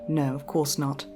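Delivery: plain reading voice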